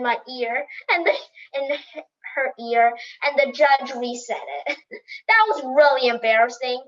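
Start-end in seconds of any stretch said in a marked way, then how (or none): none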